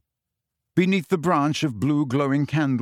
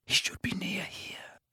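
voiceless whisper; 7 semitones higher, 10 dB quieter than usual. A narrator's reading. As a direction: whisper it tensely. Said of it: "whispered Harry".

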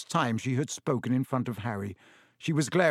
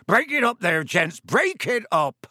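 loud, raised shout over a brawl; booming order; 7 semitones higher, 6 dB louder than usual. A narrator's reading. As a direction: bellow to separate the fighters. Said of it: "louder than all".

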